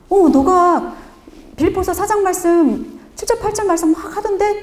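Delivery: light up telling him what he did; excited mother. Retold or imitated. Mother excitedly telling her son.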